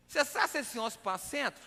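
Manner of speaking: thick Minas Gerais dialect, syllables swallowed; comic Mineiro accent, words melting together; mineirês. Retold or imitated. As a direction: run the question together in slurred Mineiro speech. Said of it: "ele fala o mineirês".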